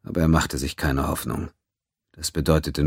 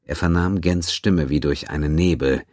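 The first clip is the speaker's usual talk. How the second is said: ordinary delivery, nothing marked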